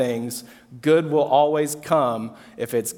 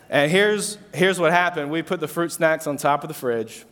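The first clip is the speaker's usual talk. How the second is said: normal talking voice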